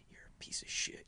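hissed insult, barely voiced; nasty whisper. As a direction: whisper it like a spiteful drunk. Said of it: "starts whispering".

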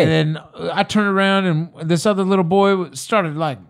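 Kevin Hart impression. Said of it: "I did that accent right there".

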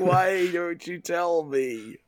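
slow, high, drawn-out wail; hammed-up frustrated parent plea; mock pleading. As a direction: beg in exaggerated desperation, stretching every word.

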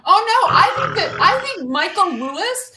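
high laughing voice. Real, not acted. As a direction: laugh through the words. Laughing at the movie idea.

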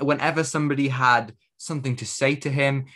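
ordinary delivery

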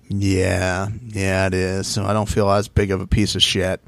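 low, subdued imitation of an emotional admission; deep in his feels. Podcaster imitating contestant admitting it to Mark, mock deep in feels. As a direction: lightly mimic him, low and heavy with feeling. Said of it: "he was deep in his feels".

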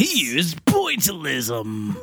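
slow, laughing trailer narrator. Acted. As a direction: hype it like a trailer. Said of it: "joins the announcer bit".

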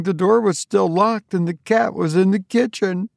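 high, intent ghost-story telling; eerie account. A narrator's reading. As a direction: convince her sister it was there. Tabitha tells a ghostly tale.

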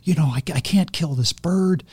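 hushed, high-pitched mock horror at himself; acted remorse, breathy and soft; remorseful. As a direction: half-whisper it, aghast and regretful. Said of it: "this tremendous remorse".

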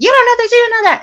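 very high mock nagging; mimicked cutting-off. Drunk woman cutting off the speaker, mock insistent. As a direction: imitate her interrupting, pitched right up.

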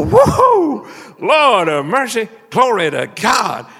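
loud, high joyful whoa, then praise; retold praise shout. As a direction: burst out shouting praise to the Lord. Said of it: "and I'd shout".

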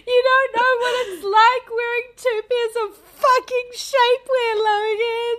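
high, loud exaggerated complaint; mock rant. Speaker ranting to Logan about shapewear, loud and high.

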